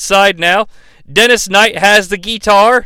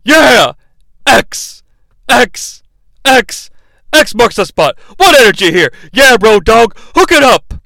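loud, higher mock cheering; hyped commentator; 4 semitones higher, 4 dB louder than usual. Imitating Shane hyping D X, loud mock cheers. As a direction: ham up an overexcited announcer. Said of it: "makes a statement with each chop".

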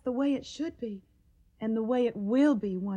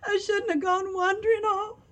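tearful; 7 semitones higher than usual. Acted. Daughter blames herself, grieving.

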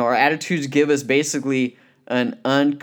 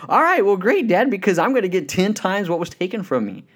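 much higher, louder imitation of delight; acted-out giddy excitement; excited daughter; 9 semitones higher, 3 dB louder than usual. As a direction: gush happily as the thrilled daughter. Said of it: "She got all excited".

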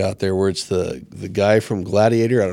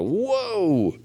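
whoa; 10 semitones higher than usual. Retold or imitated. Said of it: "I was like".

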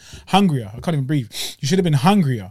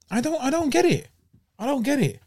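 much higher, baffled; exasperated disbelief; 10 semitones higher, 3 dB quieter than usual. Real, not acted. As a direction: throw up your hands, pitched way up. Exasperated at Broner's fight.

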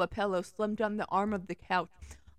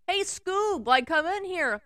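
Shaggy voice; 9 semitones higher, 4 dB louder, slower than usual. Acted. Shaggy voice calling.

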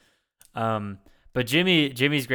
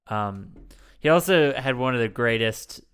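ordinary delivery

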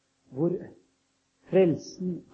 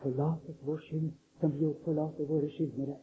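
hushed prayer; 6 dB quieter than usual. Acted. The people pray for daily bread, hushed and soft. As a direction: pray it quietly, almost to yourself. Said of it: "Og de roper".

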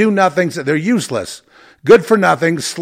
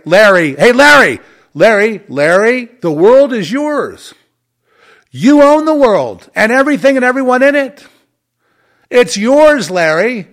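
loud, higher, hammed-up hailing; mock exalting; 7 semitones higher, 6 dB louder than usual. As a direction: crown him with fake glee. Sarcastic hailing of Larry.

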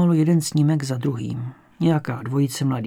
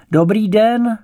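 loud, higher-pitched call into the house; as loud as possible; 7 semitones higher, 7 dB louder, slower than usual. A narrator's reading. Detective calling into a silent house, as loud as possible. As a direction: call out a greeting as loudly as you can. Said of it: "co nejvíc nahlas".

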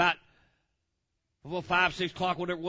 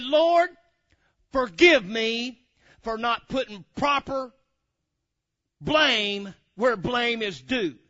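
prayer; 4 semitones higher, 7 dB louder, much slower than usual. Retold or imitated. Praying for forgiveness.

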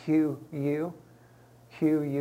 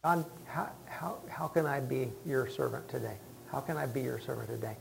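whispered prayer; 6 dB quieter than usual. Real, not acted. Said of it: "praying to God".

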